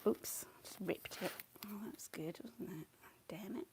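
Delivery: soft annoyed whisper. Real, not acted. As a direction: mutter it under breath. Paper ripped, muttering.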